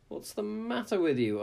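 high, fussy hen voice; fussy clucking hen. A narrator's reading. Hen voiced, much higher.

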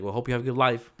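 retold sassy parting shot; snippy send-off. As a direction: imitate a clerk's pointed goodbye. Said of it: "like, just like that".

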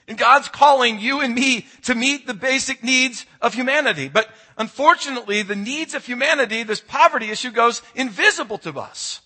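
high, loud, driving preaching voice; preaching at full force. Acted. Pastor urging the congregation about homelessness, fervent preaching. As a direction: build to the peak and press the point.

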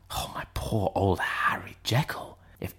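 whispered in dread; a whispered lament for Jekyll; hushed whisper. A narrator's reading. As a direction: breathe it out, horrified. Utterson's horrified private musing.

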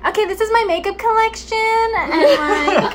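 very high, put-on makeup influencer pitch; YouTuber voice. Shown as a demonstration. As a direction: squeal it like a Gen Z beauty vlogger. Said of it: "どっから声出てんのよ".

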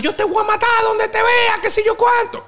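high shouted threat; hammed-up furious yell; mock threat yell. Retelling the rival's death threat, high mock shout.

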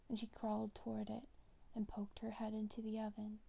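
usual speaking voice

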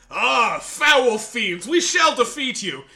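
loud, slow theatrical knight proclamation; grand heroic voice. Retold or imitated. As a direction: declaim it like a stage hero. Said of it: "I'm going, like, super into it".

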